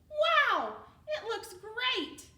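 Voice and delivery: high, loud, delighted burst; excited gasp of praise. Acted. Mayor praising Cornelius's hat, thrilled and loud. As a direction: wow him with the hat.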